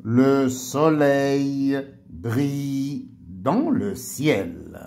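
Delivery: very slow, drawn-out dictation. Shown as a demonstration. Teacher dictating a sentence for pupils to copy, slow dictation. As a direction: dictate slowly, stretching out the words.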